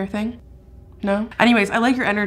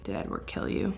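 whisper; 13 semitones lower, 12 dB quieter than usual. Real, not acted. Sudden suspicion about Edward.

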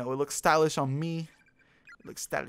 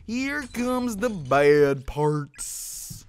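mock wail; 3 semitones higher, 5 dB louder, much slower than usual. Real, not acted. Gamer dreading the sad scene, slow loud wail. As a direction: drag it out in a hammed-up wail.

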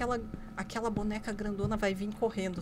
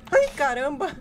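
loud, higher yelp of alarm; startled cry; 5 semitones higher, 12 dB louder, slower than usual. Real, not acted. Player sees the figure move, startled cry. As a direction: jump and cry out loudly.

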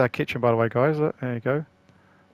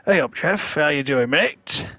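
mock Yorkshire greeting; 3 semitones higher, 3 dB louder, slower than usual. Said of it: "No, he wouldn't talk like that".

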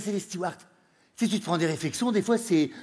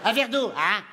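put-on Northern accent slipping back; 4 semitones higher than usual. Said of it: "ça revient".

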